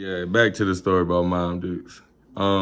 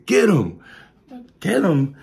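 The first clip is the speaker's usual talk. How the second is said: high, loud shout of get him; high shouted chase cry; retold shout; 10 semitones higher, 5 dB louder than usual. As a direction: yell it like a chaser.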